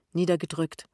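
plain reading voice

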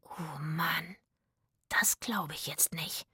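awed whisper. Acted. Zora amazed at the fountains, whispering. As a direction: breathe it in disbelief. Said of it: "flüsterte Zora".

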